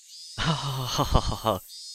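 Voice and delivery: a polite ladylike laugh rippling down; amused noble laugh after a scary tale. Acted. Character laughs at scary story.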